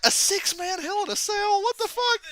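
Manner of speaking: very high incredulous squeal; hammed-up high-pitched bewilderment; mock disbelief. Acts out own disbelief.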